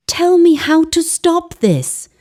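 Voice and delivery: high, desperate plea; panicked woman begging. A narrator's reading. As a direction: beg the old man for help. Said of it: "Ugoma begged".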